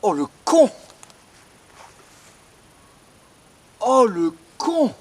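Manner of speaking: frightened shriek; shocked yelp of fright; startled scream. Real, not acted. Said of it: "J'ai failli me faire dessus".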